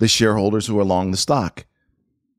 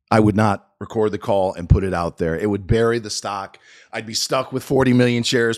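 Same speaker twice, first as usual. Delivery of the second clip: ordinary talk, nothing marked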